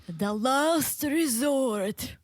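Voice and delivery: long, drawn-out spooky wail; slow, eerie ghost announcing a title; haunted ghost voice. Acted. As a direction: stretch every word like a haunting ghost. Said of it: "that was a ghost voice".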